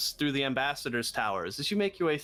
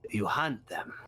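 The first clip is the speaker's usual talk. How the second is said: rasping voice; 3 semitones lower than usual. Acted. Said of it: "weird rasping voice".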